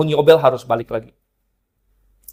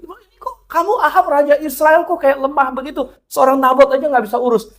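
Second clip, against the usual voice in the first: high-handed queen; 9 semitones higher than usual. Retold or imitated. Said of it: "semena-mena gitu".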